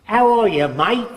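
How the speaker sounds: put-on hearty Australian-accented hello. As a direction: call out a cheery Aussie "mate" in an Australian accent. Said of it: "that guy comes from Australia".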